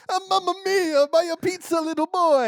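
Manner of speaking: put-on high, hammy Italian accent; Italian chef voice; jolly Italian pizza chef. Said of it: "And he says".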